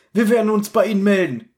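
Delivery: Wir werden uns bei Ihnen melden said in a lightly pompous tone; mock self-important Justus. Mocking Justus butting into the adults' talk: lightly pompous. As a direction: play the know-it-all boy, smug. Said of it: "sagt Justus aus dem Hintergrund".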